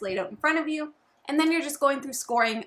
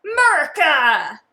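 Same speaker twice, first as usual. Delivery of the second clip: loud, higher mock-patriotic yell; hammed-up patriotic shout; 4 semitones higher, 10 dB louder than usual. Co-host announcing the America version, hammed-up shout. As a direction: belt it out like a rowdy patriot.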